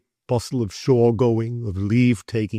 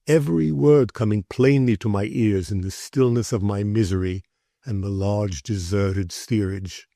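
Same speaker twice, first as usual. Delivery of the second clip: usual speaking voice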